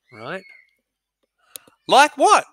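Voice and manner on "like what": much higher, sharp question; voicing Susan. Teacher reading Susan, high and sharp. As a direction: Susan challenges her brother back. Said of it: "said Susan".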